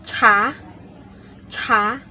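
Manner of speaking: "cha" rising, second tone. Shown as a demonstration. Teacher giving second-tone example, rising "cha". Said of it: "Sounds like".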